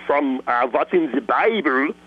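German accent. Shown as a demonstration. Voice actor demoing a German accent on one character. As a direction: lean into a German accent as the doctor. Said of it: "then you make him German".

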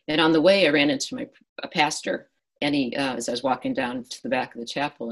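normal talking voice

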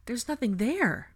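high, drawn-out baffled alarm; slow, frightened bewilderment; confused and fearful. A narrator's reading. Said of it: "announced in confusion and fear".

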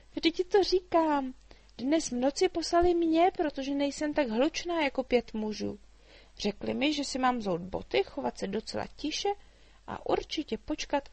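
put-on a chatty girl teasing the dragon; little girl Mája. A narrator's reading. Girl explains, amused. (vysoký živý dívčí hlas)

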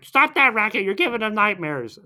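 high, loud scolding imitation; mock scolding. Recapping Lava Girl scolding the noisy ones, mock-cross. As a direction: tell them off in a hammed-up high voice.

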